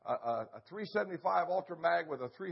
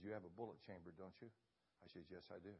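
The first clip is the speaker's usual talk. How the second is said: hushed whisper; 10 semitones lower, 22 dB quieter, faster than usual. Retold or imitated. Whispered exchange while lion stalks.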